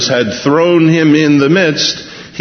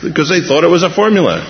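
usual speaking voice

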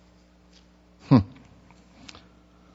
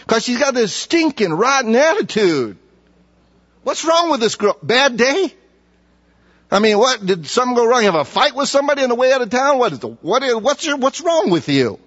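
preaching at full force; 10 semitones higher than usual. Acted. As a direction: press the crowd with rising exasperation. Preaching climax, higher and louder.

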